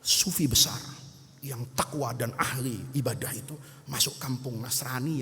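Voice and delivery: low, hushed gossiping voice; secretive gossip. Acted. Student tipping off his friend about the great teacher, secretive. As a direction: drop low and soft, letting out a secret.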